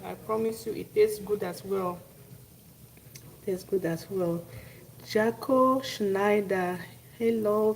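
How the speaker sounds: ordinary talk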